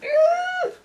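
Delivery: very high held squeak; a mortified little squeal; squeaky embarrassed ah. Retold or imitated. Turning from the judge, a high mortified squeak.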